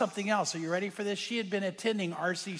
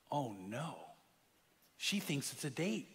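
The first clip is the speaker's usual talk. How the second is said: low, hushed inner dread; acted private dismay, very soft; dismayed thought; 4 semitones lower, 10 dB quieter than usual. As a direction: sink into a quiet realization. Rick's dismayed thought, retold.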